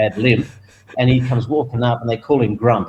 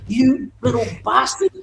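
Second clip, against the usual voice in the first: higher, fond grumble; affectionate mock insult; 10 semitones higher than usual. Owner given the trophy, grudging affectionate curse. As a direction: scold him lovingly.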